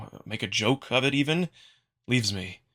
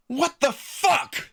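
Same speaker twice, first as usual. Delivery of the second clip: very high, slow, drawn-out outrage; a drawn-out, very high "What the fuck?" in outrage; outraged disbelief; much slower than usual. Acted. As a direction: stretch "What the fuck?" out slowly, pitched very high. Outraged repeat after rant.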